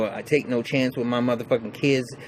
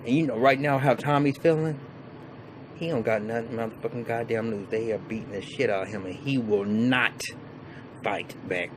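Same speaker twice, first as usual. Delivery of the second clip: ordinary delivery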